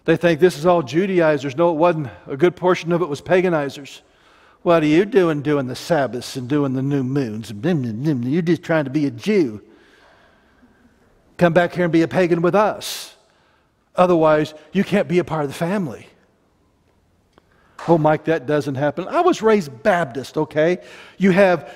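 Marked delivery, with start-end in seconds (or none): 4.6-16.1 mock scolding family. Pagan family scolding convert.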